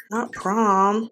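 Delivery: normal talking voice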